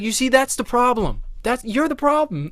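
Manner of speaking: high-pitched retold protest; exasperated accusation. Son telling Mom she is the problem, exasperated.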